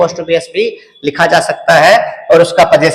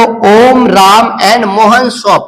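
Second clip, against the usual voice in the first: slow dictation read; much slower than usual. Shown as a demonstration. Teacher reads example phrase.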